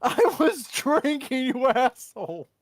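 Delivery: high, slow indignant yell; flustered outburst. Real, not acted. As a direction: wail the complaint at the teasing friend. Caught drinking by the accent.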